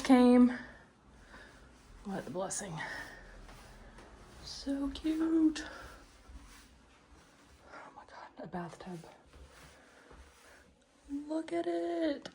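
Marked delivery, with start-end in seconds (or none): 4.4-5.6 high, gushing delight; delighted squeal. Real, not acted. Delighted by apartment. 7.7-9.0 breathless hushed wonder; awed whisper. Real, not acted. Awed by bathtub. 11.3-12.3 high, gushing delight; excited. Real, not acted. Gushing over bathtub.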